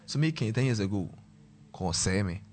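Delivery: ordinary talk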